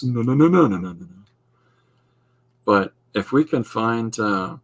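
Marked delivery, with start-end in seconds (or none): 0.0-1.1 emphatic no. Real, not acted. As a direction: firmly wave the idea off. Rejects harming others' happiness.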